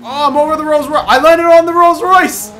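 loud, high startled shout; yelling in surprise at the crash; excited yell. Real, not acted. Car lands on rival car.

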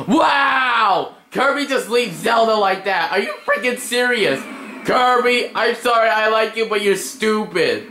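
high, indignant shout; outraged disbelief. Real, not acted. Player reacting to Kirby leaving Zelda, outraged.

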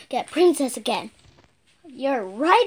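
usual speaking voice

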